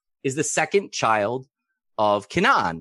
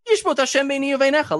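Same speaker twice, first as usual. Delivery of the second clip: high, fast indignant cry; Sarah's curse; 12 semitones higher, 4 dB louder, faster than usual. Retold or imitated. Sarah crying out against Avraham, high and indignant. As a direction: hurl the curse up high and quick.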